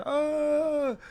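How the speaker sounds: high, weepy mock sob of a frantic wife; retold crying wife. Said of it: "my wife crying her eyes".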